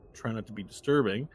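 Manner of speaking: ordinary talk, nothing marked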